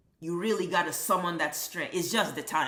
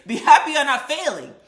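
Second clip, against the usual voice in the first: much higher and louder, yelled out; shouting; 9 semitones higher, 13 dB louder than usual. Real, not acted. Telling the C student to be grateful, shouted at the top of the voice. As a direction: yell it straight at the C student.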